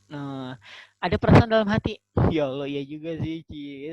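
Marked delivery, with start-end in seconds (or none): 2.3-3.9 mock-shy, flattered murmur to oneself; acted bashfulness. Praised convert feeling secretly flattered, hammed-up bashfulness. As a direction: play coy at being praised. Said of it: "sambil malu-malu gitu kan".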